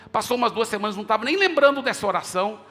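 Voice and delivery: ordinary talk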